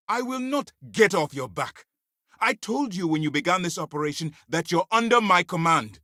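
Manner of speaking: high, insistent rebuke; angry assertion of authority. Acted. Commander reminding agent who is in command, angry rebuke.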